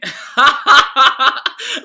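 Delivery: very loud, high burst of laughter; uproarious high cackle; big laugh. Real, not acted. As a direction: explode laughing. Amused by the copied cat.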